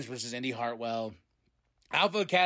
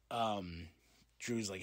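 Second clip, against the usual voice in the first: ordinary delivery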